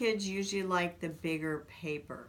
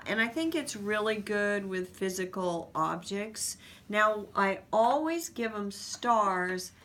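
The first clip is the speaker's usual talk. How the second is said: usual speaking voice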